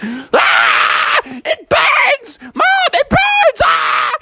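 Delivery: very high pained screaming; burned yelling. Acted. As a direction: scream like hot soup just hit your back. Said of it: "and he went".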